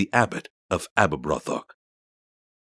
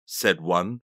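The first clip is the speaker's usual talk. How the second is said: ordinary delivery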